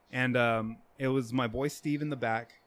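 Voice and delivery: usual speaking voice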